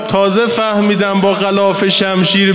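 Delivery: loud, slow grieving lament; mourning lament. Acted. Preacher voicing the wounded boy to his mother, lamenting. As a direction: pour out the child's pain to the mourners.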